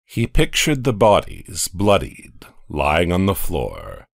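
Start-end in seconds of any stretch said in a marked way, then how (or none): none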